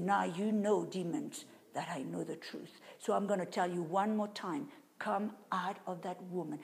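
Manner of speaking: low soft voice commanding the demon. Retold or imitated. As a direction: speak low and soft but certain. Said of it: "my voice was very low, very soft".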